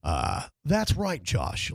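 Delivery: slow, low golf broadcast voice; hushed sports announcer read. Retold or imitated. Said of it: "you sound like".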